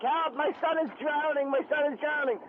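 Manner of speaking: high-pitched, repeated cry for help; mock-frantic plea, pitched high.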